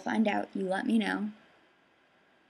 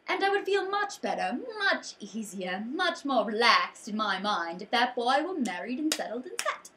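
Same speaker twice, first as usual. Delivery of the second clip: overbearing queen's lecture, theatrical, with stretched emphatic words; Queen Agravain. Acted. Queen lecturing her son about getting married, hammed-up and domineering. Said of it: "this will be Queen Agravain's monologue about marriage".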